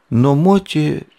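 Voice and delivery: ordinary delivery, nothing marked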